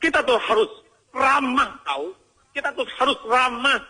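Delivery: high mock-angry insistence on friendliness; hypocritical scolding. Person preaching friendliness to others in a high, angry scolding voice. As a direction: scold in a high voice, comically at odds with the message. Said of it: "Kalau ngomong nggak cocok dengan kelakuan".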